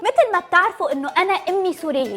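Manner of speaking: ordinary talk, nothing marked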